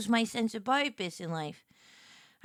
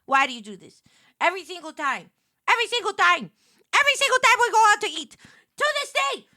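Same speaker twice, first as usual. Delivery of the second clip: building to high, loud, fast wailing complaint; hammed-up child's despairing wail; exasperated whine; 6 semitones higher, 6 dB louder than usual. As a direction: whine at dad louder and higher each time. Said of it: "And I was just like".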